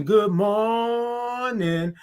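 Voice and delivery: "morning" held very long, high; drawn-out good morning. Real, not acted. Host greeting all the viewers, "morning" drawn way out. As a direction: stretch "mor" into a long high note, then land "ning". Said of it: "all those O's and R's and I N G's".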